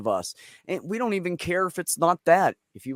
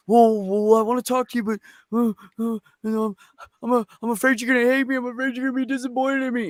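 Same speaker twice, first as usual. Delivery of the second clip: put-on nervous child; 9 semitones higher, 3 dB louder, faster than usual. Scared child confessing to parent.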